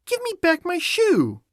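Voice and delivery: put-on angry high-pitched girl; angry little girl. A narrator's reading. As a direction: demand it in a high, angry child's voice. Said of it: "grew angry and said".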